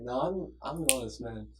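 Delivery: soft, mild teacher imitation; soothing teacher. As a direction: gently protest like a soothing teacher. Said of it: "he has the most soothing voice".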